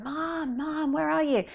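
put-on lost kid's high call, Mom drawn out; little girl calling for her lost mum. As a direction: call out across the shop floor, high and anxious.

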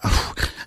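a real, heavy unvoiced sigh. Real, not acted. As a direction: let the breath go out shakily. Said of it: "still get emotional all these years later".

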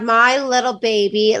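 normal talking voice

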